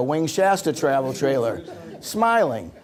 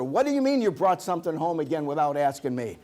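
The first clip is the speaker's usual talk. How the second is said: ordinary talk, nothing marked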